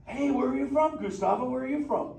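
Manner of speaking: laid-back surfer dude turtle, stoked. Acted. Turtle Crush greets the humans in the tank, surfer voice.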